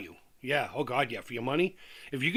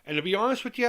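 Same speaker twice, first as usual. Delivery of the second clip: usual speaking voice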